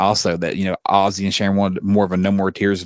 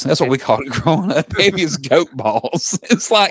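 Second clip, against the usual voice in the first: laughing; 5 semitones higher than usual. Real, not acted. Laughing at the silly phrase.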